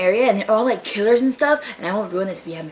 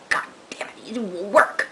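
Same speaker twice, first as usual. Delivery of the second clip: whisper. Real, not acted. Frustration, whispered.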